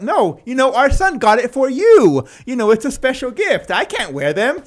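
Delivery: loud, high-pitched mock pleading; hammed-up exasperated insistence; retold protest. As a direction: re-enact arguing back, voice way up. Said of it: "I was like".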